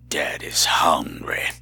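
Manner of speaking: low, slow, breathy whispered growl. Pretend. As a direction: rasp it out low and slow like a hungry creature. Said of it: "I said that like that character".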